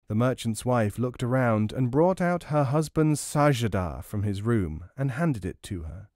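plain reading voice

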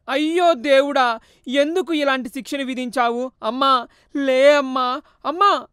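slow, loud, high wailing over his dead mother; acted sobbing lament; wailing sob. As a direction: howl it out, grief-stricken. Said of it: "బోరున ఏడుస్తున్నాడు".

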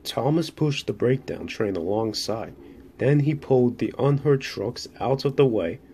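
ordinary delivery

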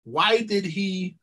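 usual speaking voice

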